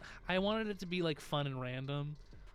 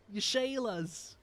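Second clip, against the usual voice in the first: higher, drawn-out mock Australian accent; put-on Australian accent; 6 semitones higher, slower than usual. Streamer teasing an Australian viewer goodbye, mock Australian accent. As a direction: stretch it out in a playful Aussie accent. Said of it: "I'm sorry for the accent".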